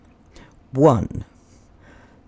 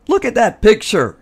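higher insistent push; demanding; 9 semitones higher, 4 dB louder, faster than usual. A narrator's reading. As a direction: point at it and insist. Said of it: "they demanded".

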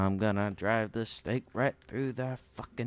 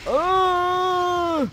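scream; 12 dB louder than usual. Real, not acted. Jump scare in horror game.

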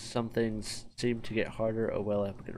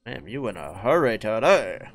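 ordinary talk

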